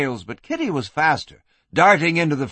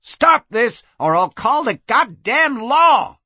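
high, loud, furious warning; shouted threat; 9 semitones higher, 5 dB louder than usual. Acted. Madam warns the brawlers, shouted threat. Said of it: "threatened Kitty".

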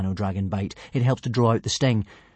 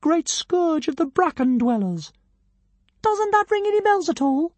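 pompous tiny dragon. A narrator's reading. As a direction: announce yourself like a pint-sized deity.

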